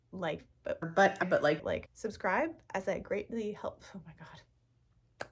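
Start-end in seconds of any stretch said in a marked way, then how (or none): 4.0-5.3 breathy whisper to herself; whispered oh my God. Real, not acted. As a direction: say it under your breath, stunned. Whispered aside.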